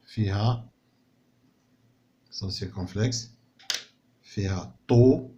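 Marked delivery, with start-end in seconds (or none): 2.3-4.8 slow dictation. Shown as a demonstration.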